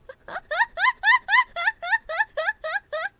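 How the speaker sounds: long, loud high-pitched cackle; uncontrolled high laughing fit; helpless laughter. Real, not acted. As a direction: burst out in a long squealing laugh. Cracks up at chat comment.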